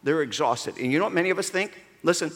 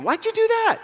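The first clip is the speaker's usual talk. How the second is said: much higher, startled question; baffled neighbor. Retold or imitated. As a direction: squeak it out in disbelief.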